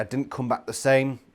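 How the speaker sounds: ordinary talk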